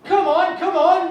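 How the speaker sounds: high, loud eager urging; egging her on, voice climbing. Acted. Urging paralysed woman to speak.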